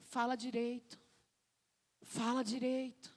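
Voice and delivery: soft, restrained command; God correcting gently. Acted. God answering a complaining believer, quiet correction. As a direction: say it quietly, with calm authority. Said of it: "Deus tá falando".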